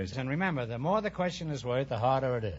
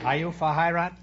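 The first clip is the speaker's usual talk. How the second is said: mock German; 4 semitones higher, 5 dB louder than usual. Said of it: "I thought I was in Berlin".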